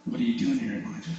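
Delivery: hushed, still small voice; gentle whisper. Acted. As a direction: ask it gently, almost under the breath. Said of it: "and he whispered to him".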